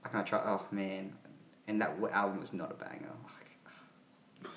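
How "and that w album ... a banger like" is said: whispering. Real, not acted. Whispered aside.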